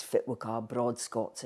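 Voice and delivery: slow, broad North East Scots accent. Shown as a demonstration. Linguist demonstrating her Broad Scots accent. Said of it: "now I've moved into my Broad Scots".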